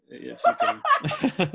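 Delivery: laughing squeak. Real, not acted. Joking about money, laughing.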